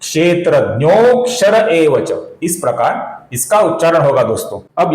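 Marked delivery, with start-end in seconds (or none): 0.0-2.1 क्षेत्रज्ञोक्षर with a long held "o"; lengthened avagraha. Shown as a demonstration. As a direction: draw out "nyo" in क्षेत्रज्ञोक्षर. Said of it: "कुछ लंबाई के साथ करते हैं".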